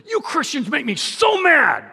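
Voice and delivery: high, indignant quote of the stranger; mock angry stranger. Preacher voicing the angry stranger in the jacuzzi, mock indignant. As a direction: play the irritated stranger.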